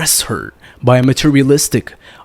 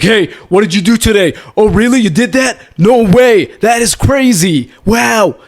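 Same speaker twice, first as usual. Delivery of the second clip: high, loud, gushing over her day; way too much energy, every line a gasp of amazement; overexcited chatter; 7 semitones higher, 4 dB louder than usual. Shown as a demonstration. Dating coach mocking a hyper first-date voice, overexcited. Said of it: "I'm not gonna be talking to her like that".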